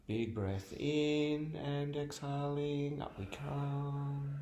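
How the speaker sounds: hushed yoga teacher calming the stretch; soft calming voice. Acted. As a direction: let the word melt away quietly. Soft guided relaxation in the stretch.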